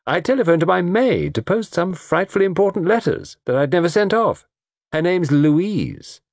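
high, airy society woman; laughing young woman. Acted. Pauline laughingly correcting the detective's guess, high and amused. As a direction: rattle it off amused, pitch held high. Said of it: "Pauline laughed".

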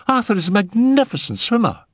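high, quick, urgent cry. A narrator's reading. Phyllis insisting Arthur can swim, crying out. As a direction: call it out high and fast. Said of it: "she cried".

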